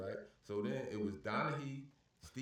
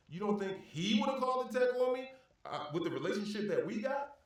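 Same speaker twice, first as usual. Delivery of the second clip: heated retort; 10 semitones higher, 4 dB louder than usual. Retold or imitated. Retold argument with referee.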